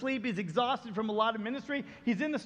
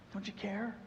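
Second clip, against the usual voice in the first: soft question; 2 semitones lower, 8 dB quieter than usual. Retold or imitated.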